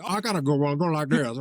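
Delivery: slurred mock-singer speech. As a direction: talk the way a grunge singer sings. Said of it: "so [name]'s like".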